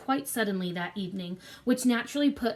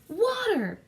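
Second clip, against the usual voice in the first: croaked; 11 semitones higher than usual. A narrator's reading. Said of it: "he croaked".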